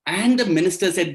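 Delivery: plain reading voice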